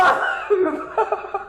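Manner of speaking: high laugh. Real, not acted. Laughs after wife quote.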